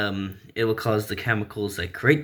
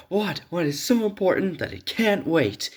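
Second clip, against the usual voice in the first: much higher, exasperated "what"; annoyed at being interrupted; irritated Bruce; 9 semitones higher than usual. Acted. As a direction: Bruce brushing off Dick's interruption.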